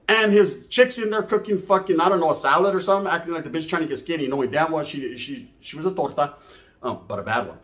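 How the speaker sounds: ordinary delivery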